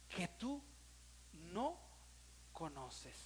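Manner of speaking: very slow, fading to soft; solemn scripture reading. Acted. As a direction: read the verse like a sacred promise. Reads scripture slowly.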